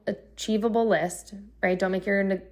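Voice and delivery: normal talking voice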